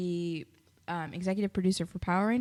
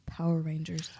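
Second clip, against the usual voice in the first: whispered reveal; 4 semitones lower, 4 dB quieter than usual. Acted. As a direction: whisper it like a secret. Dramatic reveal.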